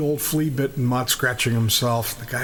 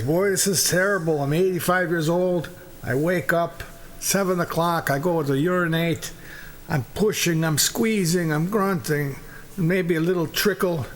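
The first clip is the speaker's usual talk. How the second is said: higher-pitched old man, lightly voiced; complaining old man; 5 semitones higher than usual. Old man tells friends his troubles, lightly voiced complaint. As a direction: grumble lightly as an elderly character. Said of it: "The first guy says".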